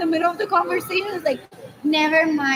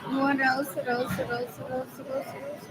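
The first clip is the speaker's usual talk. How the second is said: each repeat softer and softer, like an echo; fading echo; 9 dB quieter than usual. Acted. Talking to herself, the question echoing and fading. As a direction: let it die away into the distance.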